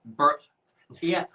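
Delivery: usual speaking voice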